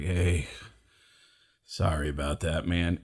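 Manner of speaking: low, soft, downcast apology; sheepish apology. Retold or imitated. Comic to musician buddies after a bad set, sheepish. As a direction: apologize quietly, pitch dropped right down.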